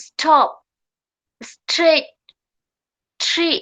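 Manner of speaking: crisp, isolated ST and TR words; uitspraakdemo. Shown as a demonstration. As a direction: say each word apart, stressing the cluster. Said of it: "এটা কিভাবে pronunciation করব".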